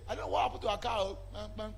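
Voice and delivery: ordinary delivery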